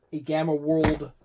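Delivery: ordinary talk, nothing marked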